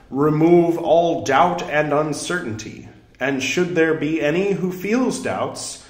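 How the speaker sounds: emphatic, proclaiming sutra reading; scripture recitation. Acted. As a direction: proclaim each phrase with swelling stress. Declaims sutra verses aloud.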